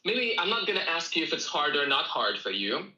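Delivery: ordinary delivery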